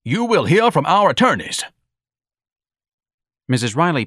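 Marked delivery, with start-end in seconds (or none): 0.0-1.6 high, loud, pompous threat; thundered. A narrator's reading. Pompous son threatening the bar owner, thundered. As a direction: proclaim it with blustering outrage. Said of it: "Ignatius thundered".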